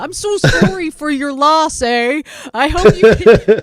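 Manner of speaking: high-pitched mock Canadian condolences; nice Canadian accent. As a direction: imitate a kindly Canadian, with a playful Canadian lilt. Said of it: "some really nice Canadian was coming up".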